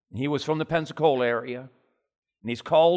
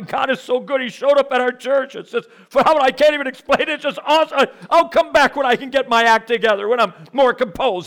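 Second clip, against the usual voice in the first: overcome with emotion; 11 semitones higher, 9 dB louder than usual. Retold or imitated. Weeping plumber overwhelmed by God.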